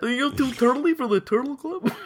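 high Dana Carvey voice, tickled; Dana Carvey impression. GM quoting Dana Carvey bit, lightly high impression. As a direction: do the turtle club line as Carvey. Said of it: "Dana Carvey, very good".

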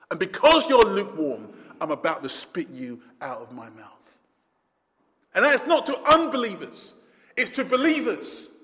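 normal talking voice

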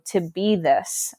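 ordinary talk

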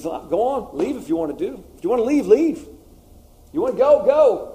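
acted-out insistent send-off; emphatic challenge. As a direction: throw down the challenge, punching it high and loud. Jesus challenging the disciples, acted.

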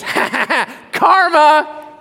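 put-on high, loud laughing taunt in a little girl's voice; gloating laugh. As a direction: cackle the word at him. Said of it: "And she goes".

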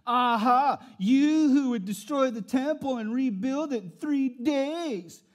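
loud, mocking jeer from the crowd; deriding passerby. Passerby jeering at Jesus on the cross, loud mockery. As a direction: taunt him from the roadside. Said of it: "those who passed by derided him".

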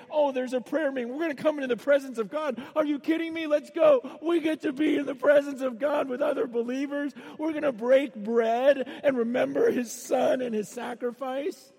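can't wait to get to the prayer meeting; eager believers. Retold or imitated. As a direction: believers in India thrilled by a prayer meeting, shouting with joy. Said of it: "the believers there".

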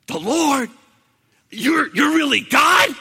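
high, loud stunned question; amazed disbelief. Retold or imitated. Jack learning the voice is God, astonished. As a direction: ask it astonished, voice up.

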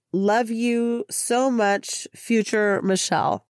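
normal talking voice

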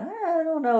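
high, noncommittal hedge; doubtful. Retold or imitated. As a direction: voice a skeptical thought way up high. Quotes own doubtful thought.

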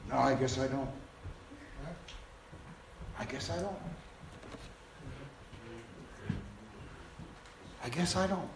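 soft, low dry deadpan. Acted. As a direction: say it dryly, unconvinced. Deadpan pastor reply.

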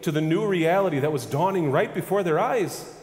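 high, loud preacher's cry; preaching at full force. Acted. Preacher lifts his voice to the congregation, rising proclamation.

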